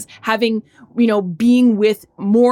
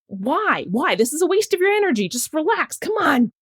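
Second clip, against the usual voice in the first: higher, quicker self-talk, half pleading; a touch of exasperated self-coaching; inner exasperation; 7 semitones higher than usual. Retold or imitated. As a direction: talk yourself down from anger. Voicing her own inner calming thoughts.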